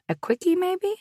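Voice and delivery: playful, teasing high lilt; joking. A narrator's reading. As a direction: toss it off as a cheeky joke. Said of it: "I joked".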